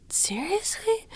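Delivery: a high, breathless whispered disbelief; stunned whisper. Retold or imitated. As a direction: whisper it as if nobody must hear.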